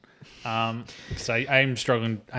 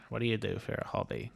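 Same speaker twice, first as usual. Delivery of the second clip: quiet Tom Conti voice; 10 dB quieter than usual. Retold or imitated. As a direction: murmur it like Conti. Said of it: "'Cause he talks quiet".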